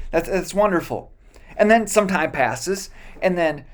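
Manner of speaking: ordinary talk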